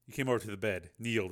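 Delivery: plain reading voice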